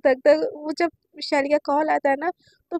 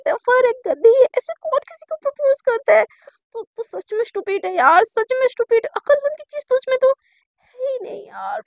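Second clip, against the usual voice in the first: high, loud, panicky cursing at herself; frantic self-scolding; 7 semitones higher, 5 dB louder than usual. Retold or imitated. Girl scolding herself after a botched proposal, high and frantic. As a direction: beat yourself up in a rising panic.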